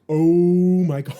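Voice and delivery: slow, loud laughing shock; shocked exclamation. Retold or imitated. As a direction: gasp it out, stunned and amused. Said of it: "And I was like".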